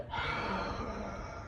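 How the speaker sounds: slow breath let out all the way; long exhale. Shown as a demonstration. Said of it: "and then you go".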